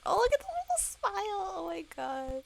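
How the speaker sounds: high, loud squeal of delight; real high-pitched fangirl outburst; delighted gushing. Real, not acted. Gushes over character's little smile.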